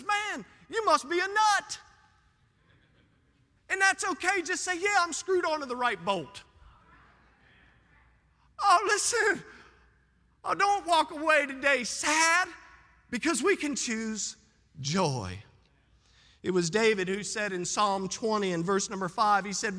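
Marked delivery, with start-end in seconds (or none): 0.0-1.8 mock scoffer. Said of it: "the world looks at you and says". 3.7-6.4 full-force preaching. Real, not acted. Preacher urging congregation, high fervent preaching. 8.6-15.4 high, louder call out; fervent appeal. Real, not acted. Preacher to congregation, high loud pleading call.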